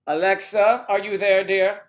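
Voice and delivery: calling Alexa loudly. Real, not acted. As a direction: call out to Alexa across the room.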